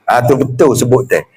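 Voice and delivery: ordinary talk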